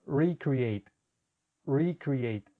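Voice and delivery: "recreate" in clear English, short ee at the start; English pronunciation. Shown as a demonstration. Teacher modelling the English word for students. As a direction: say "recreate" the English way, ee not lengthened. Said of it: "En este caso se pronunciaría".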